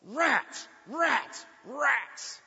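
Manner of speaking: high, loud, sharp bursts; retold yell. Driver cursing the truck that cut across, yelled. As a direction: yell it out as three separate cries. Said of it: "but I yelled".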